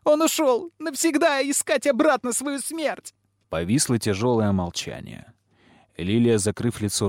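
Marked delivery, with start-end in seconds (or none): 0.0-3.1 very high tearful wail; screaming through sobs. A narrator's reading. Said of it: "исказилось в крике и рыданиях".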